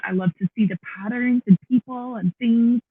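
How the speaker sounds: ordinary talk, nothing marked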